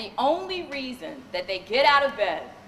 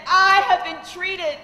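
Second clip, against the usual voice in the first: tearful client; 5 semitones higher, 5 dB louder, slower than usual. Retold or imitated. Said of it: "she said".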